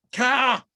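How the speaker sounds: a loud caw like a crow; crow caw imitation. Man greeting a crow, loud imitated caw. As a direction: call out like the crow.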